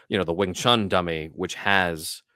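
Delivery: normal talking voice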